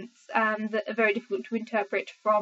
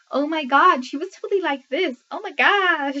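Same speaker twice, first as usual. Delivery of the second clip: higher, hammed-up Valley Girl American accent; 5 semitones higher, 5 dB louder than usual. Shown as a demonstration. Brit showing her terrible American accent to viewers, mock Valley Girl. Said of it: "Valley Girl type thing".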